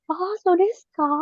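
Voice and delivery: high, loud gossipy "so desu ka?" with a falling end; a gossiping friend reacting to news. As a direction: gush "Oh, so desu ka?" like a gossip hearing juicy news. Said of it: "Kalau gosip-gosip".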